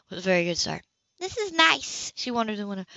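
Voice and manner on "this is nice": higher, gentle social worker voice; a light lift for the kind social worker; lightly voiced woman. A narrator's reading. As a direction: voice Anna a touch higher and friendly. Anna voiced by narrator.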